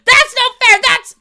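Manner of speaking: high, loud indignant cry; Angela's outburst. Retold or imitated. Quoting a Smosh video, high loud indignant cry. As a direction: wail it as a protest. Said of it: "Angela's like".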